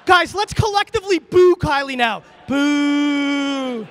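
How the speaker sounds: high, loud playful shout; rally the crowd to boo. Pretend. Leads crowd booing.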